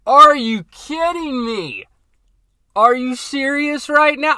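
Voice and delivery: high, slow, loud outraged disbelief; furious yell. Retold or imitated. Retelling his reaction to the cancelled job, slow furious yell. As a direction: bellow it like the job just got yanked away. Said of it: "I was pissed".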